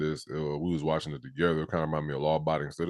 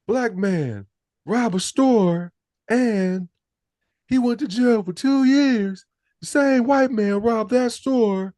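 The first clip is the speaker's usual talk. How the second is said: high, loud, slow drawn-out movie voice; imitating the character; 6 dB louder, slower than usual. Podcaster reenacting the film's courtroom speech, high and slow. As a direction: lay out the evidence, preaching slow and high. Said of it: "that's his voice".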